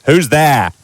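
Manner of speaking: loud, high challenge; shouting. A narrator's reading. Uncle Vernon shouting at the unseen intruder. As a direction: bark it at the door. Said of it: "he shouted".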